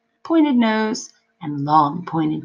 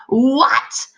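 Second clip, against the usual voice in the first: startled demon; 7 semitones higher, 5 dB louder than usual. A narrator's reading.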